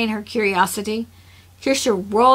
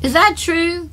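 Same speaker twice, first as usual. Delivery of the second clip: lightly voiced curious child, higher pitch; child's question; 7 semitones higher, 4 dB louder than usual. A narrator's reading. Kilty checks the claim with Kirian, innocently curious. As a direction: pitch it up like a little one asking.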